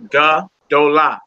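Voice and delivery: Ga-dola, slow, each syllable apart, ga-DO-la; corrected pronunciation. Shown as a demonstration. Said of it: "I will pronounce that the Gola".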